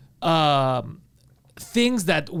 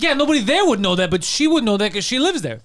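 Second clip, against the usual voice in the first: higher, louder sarcastic echo of his words; mocking repeat; 6 semitones higher, 3 dB louder than usual. Mocking the guy's witch logic, higher sarcastic echo. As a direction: parrot him back with scorn. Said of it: "It's like".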